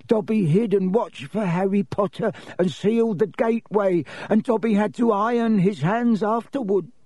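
high, squeaky little elf voice; high-voiced house elf explaining himself; Dobby the elf, earnest. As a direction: confess it in a high-pitched servile voice.

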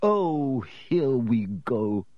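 drawn-out, sinking grumble; bitter. A narrator's reading. Ghost host sees the headless horsemen arrive, bitterly. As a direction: groan it with weary resentment. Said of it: "said Nearly Headless Nick bitterly".

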